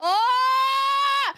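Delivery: sudden high cry of pain; pained shout. Real, not acted. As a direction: yelp out as the burn hits.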